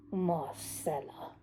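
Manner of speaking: slow, drawn-out sneer; lingering over her name. Acted. Joshua sneering at the maid, slowly lingering over her name. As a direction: drag the name out slowly, with contempt. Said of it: "He lingered over her name".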